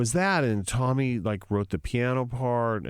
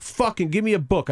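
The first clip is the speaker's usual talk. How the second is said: higher and louder, demanding a book; fed-up demand, voice raised; frustrated outburst; 9 semitones higher, 4 dB louder than usual. Retold or imitated. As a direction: snap it out in exasperation. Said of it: "And it's like".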